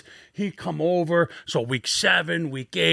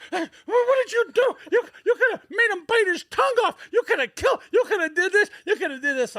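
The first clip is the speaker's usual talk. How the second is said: much higher, panicky sputtering coach voice; frantic coach imitation. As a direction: squeal it like a coach losing his mind. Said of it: "he went wild. You, he couldn't even talk".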